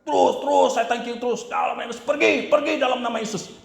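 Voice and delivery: high, relentless exorcism commands; retold shouting. Preacher reenacting sweating through a deliverance, retold shouting. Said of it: "teriak saya".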